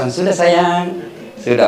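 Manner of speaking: soothing. Retold or imitated. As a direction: soothe an angry wife gently. Soothing spouse quote. (menenangkan istri dengan lembut)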